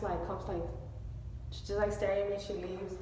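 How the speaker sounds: ordinary delivery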